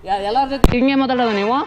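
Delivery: real angry shouting match; furious shouting. Real, not acted. Rider throws insults back in a quarrel, furious shouting. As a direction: hurl it back at full volume.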